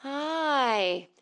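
sustained "Hi" falling gently from a high start; voice-coach correct speech. Shown as a demonstration. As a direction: stretch "Hi" out like a vocal exercise. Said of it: "teach us how to talk correctly".

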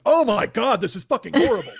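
loud, hammed-up revulsion; mock disgust. Pretend taster reacting to sausage cake, mock disgust. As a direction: blurt it out as a pretend appalled taster.

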